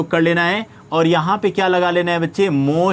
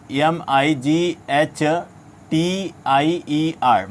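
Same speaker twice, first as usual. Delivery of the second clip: slow spelling; much slower than usual. Shown as a demonstration. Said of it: "teacher spelling word for students".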